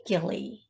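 Gilly with a hard G. Shown as a demonstration. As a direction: say "Gilly" starting like "give". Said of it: "Gilly or Jilly".

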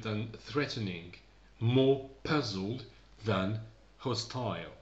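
very slow, deliberate text reading; read-aloud for learners. Acted. Teacher reads first paragraph to learners, slow measured reading. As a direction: read slowly, phrase by phrase, pausing between them.

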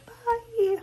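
Bye squeaked very high, breaking; high sign-off. Shown as a demonstration. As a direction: attempt a sing-song Bye way up high. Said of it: "I can't even do that".

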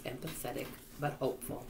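ordinary delivery, nothing marked

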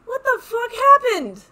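high, loud and slow disbelief; stunned yell of disbelief; shocked outburst. Real, not acted. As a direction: blurt it out in shock at what just happened. Shocked by the game.

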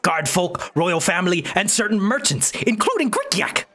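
high broken-English goblin; squeaky little goblin merchant. Acted. Goblin voice continues.

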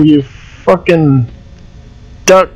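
normal talking voice

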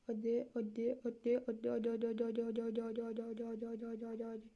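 fading into a tense murmur; muttering to himself, fading away; tense muttered urging. Real, not acted.

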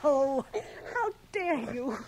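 loud, slow playful shriek of mock offence; mock outrage. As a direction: squeal as if truly insulted, half laughing. Nose playfully twisted by Dunny.

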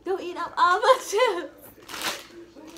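slow, laughing protest. Real, not acted. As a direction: laugh through the words. Laughing at friend eating chips.

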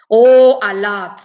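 usual speaking voice

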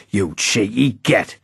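slow, spat-out insult; a hint of an angry northern bruiser. A narrator's reading. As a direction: spit the words at the lad. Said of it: "he spat".

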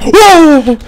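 loud high startled whoa; startled shout. Real, not acted. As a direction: yelp in sudden fright. Sudden scare.

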